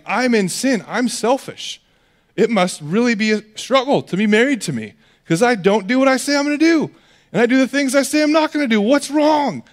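high, rising, fast whiny confession; pleading sinner. Retold or imitated. Preacher voicing a guilty husband, high and whining.

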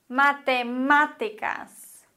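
Matemáticas, slowly, stress on -má-; Matemáticas spoken slowly for the learner; slow Spanish example. Shown as a demonstration. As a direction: give Matemáticas its stress on the third syllable má. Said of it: "Ich habe drei Beispiele".

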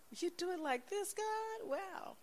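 very high, soft, wondering; hushed high astonished wow; awed wonder. Retold or imitated. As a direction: marvel quietly at God. Speaking to God in awe.

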